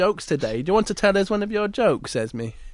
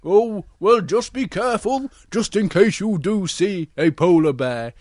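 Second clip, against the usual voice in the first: loud, sing-song cautioning bear; Big Bear voice; 7 dB louder than usual. Acted. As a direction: caution the cub loudly. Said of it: "And Big Bear".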